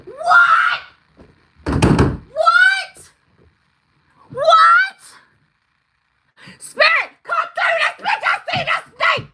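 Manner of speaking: high, loud shrieks of shock; real excited screaming. Real, not acted. Psychic reader shocked by the snake sign, screaming. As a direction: scream it out, overwhelmed with disbelief. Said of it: "Sorry, I'm screaming".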